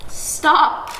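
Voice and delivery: usual speaking voice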